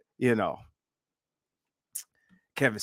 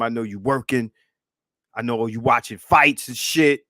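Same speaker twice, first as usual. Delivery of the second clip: ordinary talk, nothing marked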